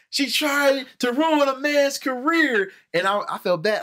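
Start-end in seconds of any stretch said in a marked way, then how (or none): 0.1-2.7 high, slow, whiny mock of angry commenters; mock scandalized squeal; mocking upset fans. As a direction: sneer it out like an offended fan. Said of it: "they was like".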